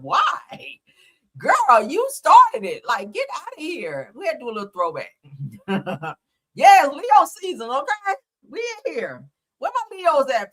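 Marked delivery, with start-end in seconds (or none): 0.0-4.1 high, loud, slow scolding; exasperated callout. Real, not acted. Host calling out a reality star, high, loud and slow. 6.5-10.5 high, loud cheer for Leo season; rally the Leo viewers; excited hype. Real, not acted. Host hyping her Leo viewers, high and loud.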